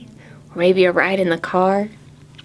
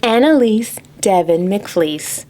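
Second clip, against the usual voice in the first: plain reading voice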